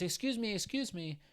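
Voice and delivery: high-pitched calling out, excuse me twice; mock driver flagging them down; imitating the taxi driver.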